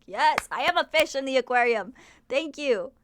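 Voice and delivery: mock eager yes. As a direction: gush like a happy fish being fed. Her mock reply to ASMR.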